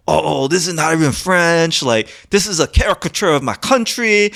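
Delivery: put-on high-pitched, indignant French accent; heavy French accent. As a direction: imitate an outraged Frenchman's thick accent. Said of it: "heavy French accent, he was like".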